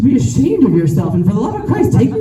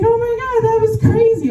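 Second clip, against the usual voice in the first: much higher, airy mom impression; mom's dizzy voice; 14 semitones higher than usual. Comedian imitates her mom, high and dreamy. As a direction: play the spacey mom, lilting up high. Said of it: "She's like".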